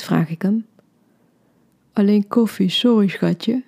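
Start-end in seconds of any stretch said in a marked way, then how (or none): 1.9-3.6 sleepy words through a yawn. Acted. Boyfriend answering from bed, yawning. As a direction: yawn it out half asleep. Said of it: "gaapt hij".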